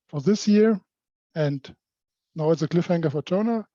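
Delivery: ordinary talk, nothing marked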